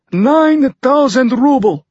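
put-on high, loud, slow Russian-accented announcement; broken English, Russian accent. A narrator's reading. Fyodor names his price to Tucker, in Russian-accented broken English. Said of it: "he said in English".

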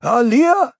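high, loud hail; man calling out from the crowd; shouted. A narrator's reading. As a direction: shout to her across the hall. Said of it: "he shouted".

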